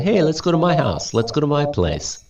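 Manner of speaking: chummy, quick and high; friendly invitation. Retold or imitated. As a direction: call a friend over, quickly. Sounds like something you would say to a friend.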